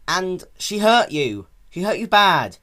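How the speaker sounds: high, loud and pleading; loud near-tearful plea; upset insistence. Acted. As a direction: press it, close to tears. Upset child dragon insisting.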